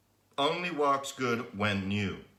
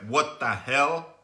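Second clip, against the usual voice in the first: rising, louder disbelief, dragged out slowly; higher, slow, fed-up complaining; exasperated rant; 7 semitones higher, 7 dB louder, slower than usual. Retold or imitated. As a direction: vent at the engine like it betrayed you. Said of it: "I'm like".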